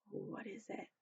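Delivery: frightened whispered question; scared whisper. Acted. Toys see a dark shape at the window, frightened whisper. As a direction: whisper it in fear.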